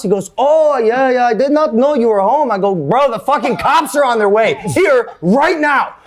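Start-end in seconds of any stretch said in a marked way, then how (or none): 0.4-2.5 high, hammed-up Swedish accent. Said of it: "that's the best Swedish accent I got". 2.7-6.0 high, urgent retold warning; worked-up, emphatic scolding. Retold panicked warning to the kid.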